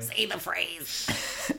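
slow, drawn-out mimicry of co-host; imitating co-host. Host mimics co-host who demanded she talk like him, teasing imitation. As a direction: stretch it out, mimicking his way of talking. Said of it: "If I talk like you".

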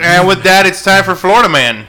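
loud, high show-host announcement; segment announcer. Pretend. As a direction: belt out the segment intro. Announces the show's recurring segment.

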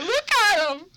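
high, loud mom squeal; drunk mom voice. Retold or imitated. Son mimicking mom pointing at her kids, high and loud. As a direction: squeal it like a proud tipsy mom. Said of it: "It's like".

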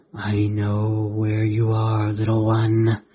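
slow, drawn-out sinister creature voice; menacing hiss. A narrator's reading. Hidden creature stalking a boy under the bed, slow menacing hiss. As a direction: draw it out on one eerie, level note. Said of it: "a voice hissed".

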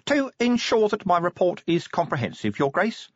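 high, precise little official; prim inspector. A narrator's reading. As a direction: answer as a fussy, deferential inspector. Fussy inspector answers Vimes.

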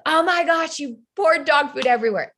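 dismayed scolding parent. Acted. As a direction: act out an appalled parent scolding a toddler. Parent scolding toddler over spill.

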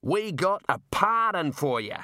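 high, slow calling voice; shouted news. A narrator's reading. Jailer shouts news of a pardon, calling out. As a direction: call it out drawn-out and high. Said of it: "he shouted".

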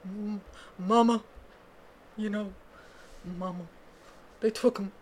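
a hint of a singer's Southern accent, mumbled; half-hearted Southern accent impression. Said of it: "speaking in this [name] accent".

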